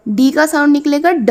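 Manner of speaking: ordinary talk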